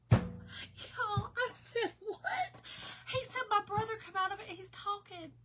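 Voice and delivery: high-pitched tearful voice, breaking into sobs; real crying. Real, not acted. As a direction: cry through astonished good news. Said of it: "I was not gonna try to cry".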